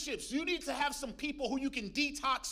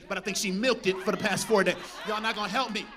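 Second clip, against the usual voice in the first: laughing tease; 2 semitones lower, 9 dB louder, faster than usual. Real, not acted. Joking about wife milking sickness.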